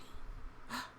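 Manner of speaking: sharp soft gasp of worry; worried gasp. Acted. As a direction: catch your breath in fright. Worried character gasps.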